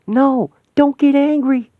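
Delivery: high, loud, urgent pleading; frantic plea. Acted. Begging an enraged friend to let go, frantic and high. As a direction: desperately pull her back from rage.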